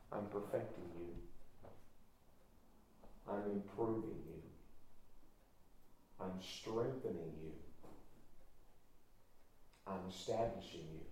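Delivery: voice of God, hushed. Acted. Preacher voicing God's gentle answer to the sufferer, hushed. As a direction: speak it gently like a reassurance.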